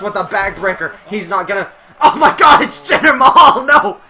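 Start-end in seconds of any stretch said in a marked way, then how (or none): 2.0-4.0 shocked shout. Real, not acted. Surprise run-in during match.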